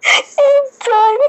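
high squeal. Real, not acted. After laughing fit.

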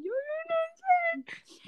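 drawn-out, high mock sobbing; llorando. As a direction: wail it very slowly in a high, crying imitation. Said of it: "Pero llorando". (imitando un llanto agudo y alargado)

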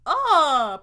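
loud groan of disgust; sharp grossed-out groan; disgusted ugh. Real, not acted. Disgusted reaction to news video.

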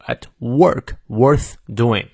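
slow, even stress on "work", "worth", "doing"; three stressed words. Shown as a demonstration. Teacher marks the stressed words, stress on "work", "worth" and "doing". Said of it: "这三个词都要重读".

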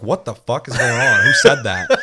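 high, loud startled yelling through laughs; mock panic at the alarm; panicked. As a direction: freak out, half laughing. Panicked retold reaction.

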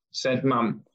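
normal talking voice

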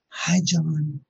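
a hint of a spooky woman saying hello; ghostly woman's voice. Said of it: "you hear this woman's voice say".

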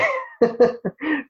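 short high laugh at a phrase he finds ugly; amused laugh. Real, not acted. Laughing to the interviewer at a phrase he dislikes, a short high laugh. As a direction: let a high little laugh slip out.

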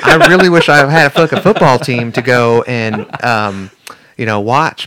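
hammed-up Southern local; Southern accent. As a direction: play a Vegas local with a Southern twang. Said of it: "I don't know why I sound southern".

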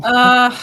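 loud, drawn-out groan; disgusted groan. Real, not acted. Hearing Bananas might save the last racer, groaning. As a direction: groan it out in open disappointment. Groans at favorite's likely fate.

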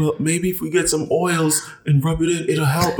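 high-pitched imitation of partner; partner's hopeful suggestion.

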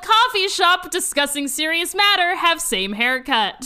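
mock newscaster, loud and formal; news anchor voice. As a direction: announce it like headline news. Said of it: "sounded like a news anchor".

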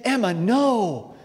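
can't believe it, drawn out; stunned disbelief. Retold or imitated. Disbelief at daughter.

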